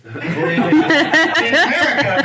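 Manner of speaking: ordinary talk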